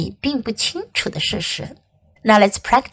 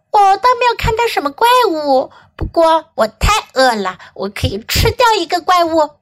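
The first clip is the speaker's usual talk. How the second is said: high-pitched little bug voice; hungry baby bug; 6 semitones higher than usual. Acted. Baby bug boasts of her hunger, high voice.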